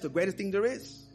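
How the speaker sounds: ordinary talk, nothing marked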